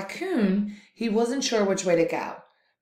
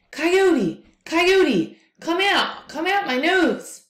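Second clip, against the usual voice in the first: high, loud pleading wail; monster begging; 9 semitones higher, 6 dB louder, slower than usual. Acted. Monster begs Coyote to leave through his nose, high pleading wail. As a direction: beg desperately, voice strained high. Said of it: "began to beg Coyote".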